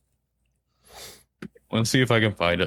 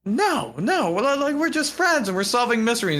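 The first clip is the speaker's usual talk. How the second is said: much higher, flustered Freddy; defensive denial squeezed up high; 12 semitones higher than usual. Retold or imitated. Freddy denying a crush, in a high flustered voice. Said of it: "He's like".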